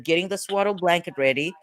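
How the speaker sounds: ordinary talk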